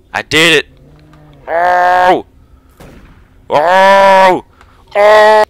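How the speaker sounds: building triumphant yells; excited whooping. Real, not acted. Gaming win, building whoops.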